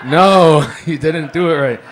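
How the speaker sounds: loud laughing no; laughing protest. Real, not acted. Teller cracking up.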